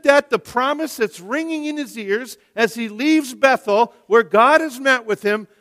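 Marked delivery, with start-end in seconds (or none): none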